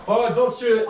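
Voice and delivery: loud urgent warning; urgent shout. Real, not acted. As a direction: shout it to stop him. Urgent warning during game breach.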